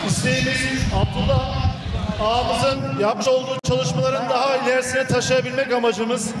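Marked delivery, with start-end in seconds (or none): none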